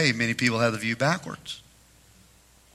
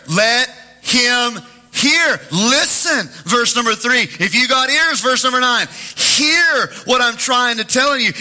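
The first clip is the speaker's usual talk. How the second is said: higher, louder preacher's call; preaching; 9 semitones higher, 4 dB louder than usual. Acted. Pastor urging his congregation to hear, full-force preaching. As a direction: urge the church to listen, rising to a cry.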